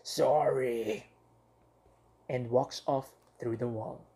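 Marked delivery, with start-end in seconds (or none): 0.0-1.1 growling. Acted. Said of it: "it growls".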